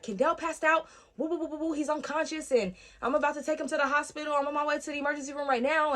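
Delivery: high, urgent retold alarm; imitated panic. Caregiver phones mother about unconscious child, high panicked imitation. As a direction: imitate a panicked caller, pitched well up. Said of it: "panicking. She's like".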